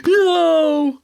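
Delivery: high, loud cry of horror; horrified cry. Retold or imitated. Scrooge sees his own grave, horrified cry. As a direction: recoil in disbelief. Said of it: "He's like".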